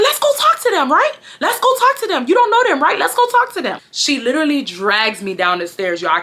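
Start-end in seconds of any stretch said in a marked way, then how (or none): 0.0-3.8 very high-pitched badgering mom imitation; insistent mom. Mom daring her child to face the car, insistent. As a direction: imitate mom calling a bluff.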